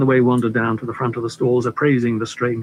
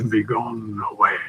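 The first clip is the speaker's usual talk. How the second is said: melodramatic thin voice; 3 semitones lower than usual. Acted. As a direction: let it fade on a longing sigh. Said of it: "The voice became melodramatically thin, a whisper of longing".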